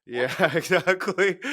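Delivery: amused laughing yes. Real, not acted. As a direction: laugh along in agreement. Agreeing with joke, laughing.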